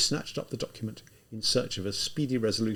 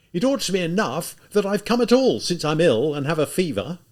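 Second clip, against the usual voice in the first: loud, high, defiant retort; losing his temper; 6 semitones higher, 7 dB louder than usual. Acted. Feverish student losing his temper at the officer, loud. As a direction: snap back, half enjoying the anger. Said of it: "suddenly also losing his temper".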